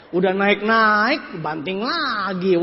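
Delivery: stretched words, swooping up then down; slow drawn-out. Acted. Speaker describing hopes rising then crashing, slow and drawn-out. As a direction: drag out the climb and the drop.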